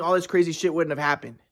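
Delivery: ordinary talk, nothing marked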